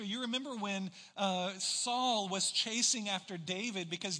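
loud, high, driving sermon delivery; preaching at full force. Acted. Preaching the David and Saul story.

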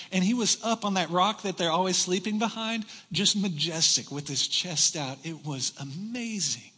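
ordinary talk, nothing marked

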